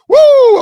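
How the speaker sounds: loud high wrestler woo yell. Real, not acted. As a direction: let out a big ringing woo. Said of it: "I'm Lord Nelson mode".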